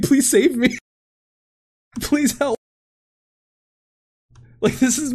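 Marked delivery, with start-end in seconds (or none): 0.0-2.6 mock plea for rescue. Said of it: "I'm like".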